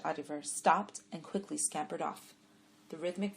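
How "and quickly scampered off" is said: plain reading voice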